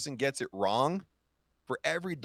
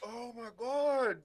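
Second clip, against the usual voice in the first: pained gasp; 10 semitones higher, 5 dB quieter, slower than usual. Real, not acted. Reacting to hot sauce pain.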